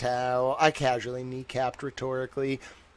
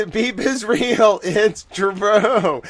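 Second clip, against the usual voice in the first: laughing; 6 semitones higher, 7 dB louder, much slower than usual. Real, not acted. Insisting the toy bunny is real.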